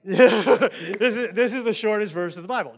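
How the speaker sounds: loud, laughing insistence; real excitement bubbling into a laugh; excited. Real, not acted. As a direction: geek out loudly over a favourite fact. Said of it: "You see how excited I get".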